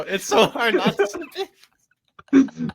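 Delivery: slow, stretched out while laughing; laughing repeat. Real, not acted. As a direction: say it again and crack up halfway. Repeating it, cracking up.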